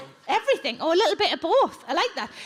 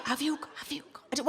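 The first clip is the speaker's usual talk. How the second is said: normal talking voice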